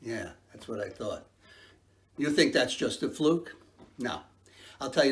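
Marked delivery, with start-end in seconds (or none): none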